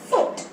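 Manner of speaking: फुट said alone, short and clear; first syllable alone. Shown as a demonstration. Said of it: "पहले बोले".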